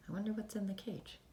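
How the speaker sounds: ordinary delivery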